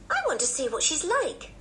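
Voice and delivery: put-on high-pitched, wishful child voice; eager little girl. A narrator's reading. Anne longing to meet her cousin, eager high child voice. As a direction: wish it aloud, pitched high like a keen child.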